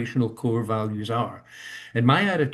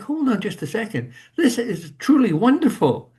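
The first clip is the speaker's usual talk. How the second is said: rising to high, louder delight on truly wonderful; laughing amazement at how wonderful it is; 10 semitones higher, 5 dB louder than usual. Retold or imitated. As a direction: inner voice bursting with amazement. Voicing his own delighted thought.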